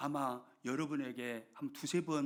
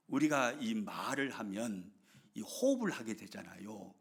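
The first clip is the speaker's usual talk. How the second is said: ordinary delivery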